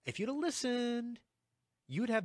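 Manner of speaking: high, held-out teasing tone; playful high-pitched chiding; teasing I-told-you-so. Real, not acted. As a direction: pitch it way up to needle them. Teasing listeners who ignored his advice.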